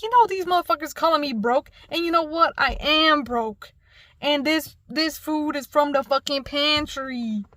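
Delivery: indignant mock address to a crowd; defiant speech. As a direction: stand up and call out your bullies, retold with gusto. Retold stand-up speech to class.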